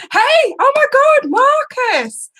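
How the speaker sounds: high, loud delighted hello; squealing welcome; excited greeting. Real, not acted. Greeting long-lost friend in chat.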